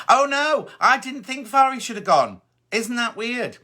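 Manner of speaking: high-pitched, surprised protest; taken aback, voice lifted high; surprised disagreement. Real, not acted. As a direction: react with real surprise, pitched well up. Disagrees with viewer's elimination verdict.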